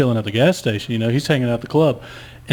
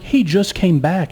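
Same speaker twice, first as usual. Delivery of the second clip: exasperated insistence; 7 semitones higher, slower than usual. Retold or imitated. Retelling insistence to police.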